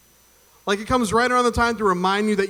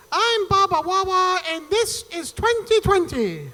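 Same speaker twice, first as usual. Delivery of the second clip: much higher, slow, deliberately bad Barbara Walters voice; Barbara Walters impression; 13 semitones higher, slower than usual. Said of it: "the worst impression that I do ever".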